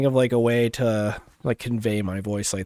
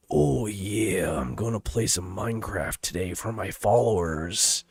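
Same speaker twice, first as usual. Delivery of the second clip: lower, rasping whispery wrestler voice; Randy Savage. Shown as a demonstration. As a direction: growl it like a wrestler promo. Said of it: "go lower with it".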